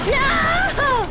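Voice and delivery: high, loud, desperate screams; panicked scream. Real, not acted. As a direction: scream like the hit is coming now. About to die in the game.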